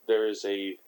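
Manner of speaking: ordinary delivery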